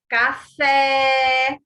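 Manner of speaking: "café" with FÉ held long on a level tone; final syllable drawn out. Shown as a demonstration. Teacher giving examples of oxítonas, "café" with FÉ stretched. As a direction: call "café", dragging out FÉ. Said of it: "Sílaba tônica é a última".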